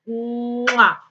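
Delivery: drawn-out "mwah" kiss; kiss sound. Real, not acted. Teacher saying goodbye to missed pupils, a big "mwah" kiss.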